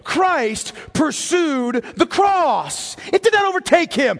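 preacher urging the congregation to see Christ's choice; impassioned preaching. Acted. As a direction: drive it into their souls. Sermon climax.

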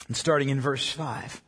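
normal talking voice

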